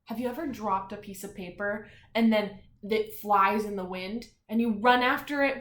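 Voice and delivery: usual speaking voice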